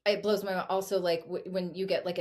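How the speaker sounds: ordinary talk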